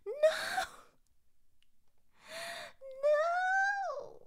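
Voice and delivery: very high wailing no; overwhelmed protest. Real, not acted. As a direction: cry out high, as if begging.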